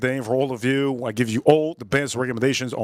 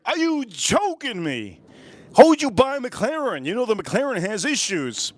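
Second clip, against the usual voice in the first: exasperated friend; 7 semitones higher than usual. Retold or imitated. Scolding friend in acted quarrel.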